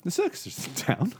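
a very high, chatty landlord voice; barman voice. Acted. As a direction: voice the barman breaking the news. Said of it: "He goes to the rabbit".

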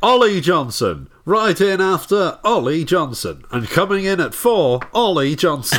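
high, loud leaderboard announcer. Pretend. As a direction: call the name like an arcade host. Arcade leaderboard announcer mockery.

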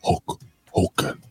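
slow, hoarse whispered promo; raspy whisper. Acted. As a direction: drag it out breathily. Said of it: "my impression of the Ultimate Warrior".

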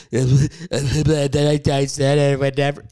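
imitating blubbered, broken speech; hammed-up crying while talking; mock cry talk. Shown as a demonstration. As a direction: babble through fake sobs. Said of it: "When you".

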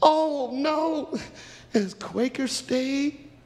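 high, drawn-out oh no; acted dismay. Girls dismayed by more oils.

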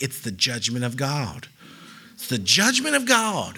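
lightly imitated doom preacher, low first and rising on the repeat; a hint of mock prophecy; mock church voice. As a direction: pronounce judgment as a put-on zealot. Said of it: "I don't know why I do the voice".

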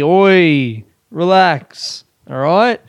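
normal talking voice

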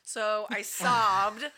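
slow, loud, drawn out; loud dramatic admission. Real, not acted. Reader loudly confessing how much books made her cry. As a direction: announce it like a big confession.

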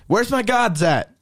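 lightly voiced demanding father-in-law; indignant Laban. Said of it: "he's talking about".

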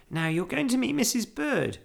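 put-on high-pitched, friendly girl; young Judy. A narrator's reading. Girl preparing bear for housekeeper, friendly. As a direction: chat brightly, pitched well up.

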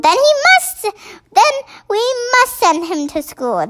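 a composed queen deciding; queen's voice. Acted.

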